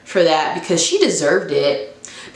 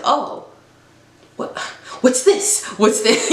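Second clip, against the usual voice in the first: surprised; 5 semitones higher than usual. Retold or imitated. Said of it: "So I was like".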